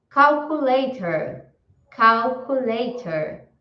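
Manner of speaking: calculator said slowly and clearly for repeating; teacher modelling calculator slowly; slow model word. Shown as a demonstration. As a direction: spell out calculator slowly, syllable by syllable. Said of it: "Eu vou falar e vocês repetem em casa".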